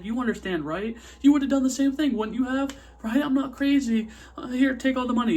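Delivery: high, loud, desperate bargaining; acted frantic pleading. Acted plea to the cop.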